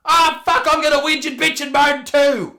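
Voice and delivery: high, loud hammed-up complainer; mock whinging outburst. Bandwagon complainer joining in the moaning, mock whinging yell.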